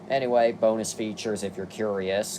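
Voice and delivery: usual speaking voice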